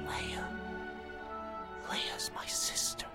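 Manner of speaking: slow, whispered wonder; hushed realisation. Acted. Pupil realising who his sister is, in a hushed whisper. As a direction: breathe it out as it dawns on you.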